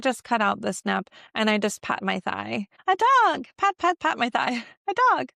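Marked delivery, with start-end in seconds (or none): 2.9-5.3 high, loud cheery dog call; very high, louder delighted shout of a dog; excited. Shown as a demonstration. Excited demo of dog sign.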